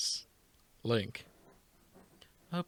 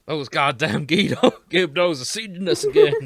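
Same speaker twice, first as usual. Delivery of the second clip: laughing Texan attempt; 11 dB louder than usual. Redoing the Texan Riju line.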